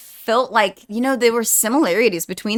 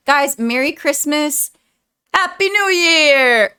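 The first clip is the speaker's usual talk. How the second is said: festive greeting; 5 semitones higher, 3 dB louder than usual. Real, not acted. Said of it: "That's why I said".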